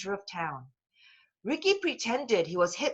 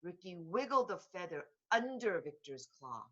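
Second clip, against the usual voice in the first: ordinary delivery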